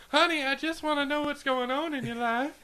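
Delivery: high-pitched southern mom voice; mom's southern accent. Acted. Co-host playing the friend's mom, southern accent. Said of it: "your mom has a southern accent".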